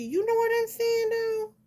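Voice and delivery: high loud diva squeal; sassy squeal. Pretend.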